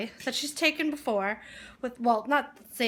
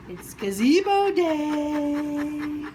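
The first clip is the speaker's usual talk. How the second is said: slow, drawn out and a bit loud; a slow, savoured, excited reveal; slow excited announcement; 3 semitones higher, 4 dB louder, much slower than usual. Real, not acted. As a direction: stretch every word for the big news.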